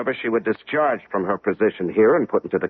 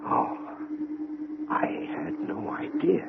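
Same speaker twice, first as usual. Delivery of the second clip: barely voiced, slow and stunned; a dazed near-whisper of realisation; awed hush; 6 semitones higher, 10 dB quieter, slower than usual. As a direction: let it slip out in a hushed daze. Stunned by the professor's plan.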